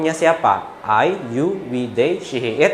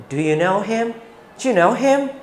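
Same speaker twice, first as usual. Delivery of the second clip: lively, higher native-English question; like a native speaker; 5 semitones higher than usual. Shown as a demonstration. Teacher showing natural expressive English. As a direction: ask "Do you know him?" as a real English speaker would, with rising energy. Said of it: "seperti orang Inggris ngomong pada umumnya".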